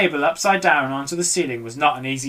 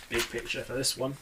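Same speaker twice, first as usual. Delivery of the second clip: much softer spoken aside; 3 semitones lower, 14 dB quieter than usual. Real, not acted. Reader comments on the picture, soft.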